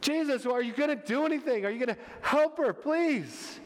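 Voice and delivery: high-pitched, urgent pleading; acted desperate questioning; imploring appeal. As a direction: press him, stretching the final please. Voices exasperated appeal to Jesus.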